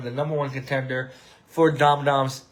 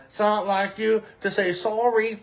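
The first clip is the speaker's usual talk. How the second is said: higher, lightly Canadian sung quote; mock Canadian accent on a song line; 6 semitones higher than usual. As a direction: imitate a singer's Canadian "sorry". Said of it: "I always hear his Canadian accent".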